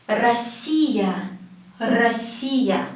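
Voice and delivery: "Россия" as ra-SSI-ya; O pronounced as A. Shown as a demonstration. Teacher modelling the country's name, "Россия" said ra-SSI-ya. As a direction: open A, then stressed long "си" in "Россия". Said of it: "we pronounce it as A".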